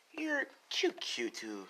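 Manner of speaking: drawn out like sweet poison; creepy cooing villain. Acted. Toga cooing over helpless Izuku, high slow creepy coo.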